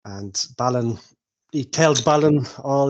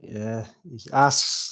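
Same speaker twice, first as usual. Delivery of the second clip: ordinary talk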